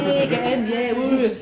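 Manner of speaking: Glasgow accent. Shown as a demonstration. Session chair demos Glasgow accent to the room. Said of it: "This is an authentic Glasgow accent".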